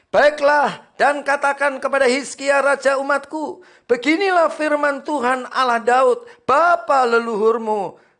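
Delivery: loud, high scripture reading; full-force preaching. Acted. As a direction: proclaim it to the congregation. (membaca ayat dengan suara khotbah lantang)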